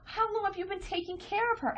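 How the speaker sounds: high, soft cooing voice; gushing stranger. Retold or imitated. Park stranger praising the nanny, high soft cooing. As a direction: coo it like a sweet busybody. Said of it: "And the woman says".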